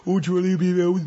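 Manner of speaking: high, gushing mock baby voice; exaggerated goo-goo pet talk. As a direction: babble at a dog as if it were a baby. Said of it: "talking to your dog in a baby voice".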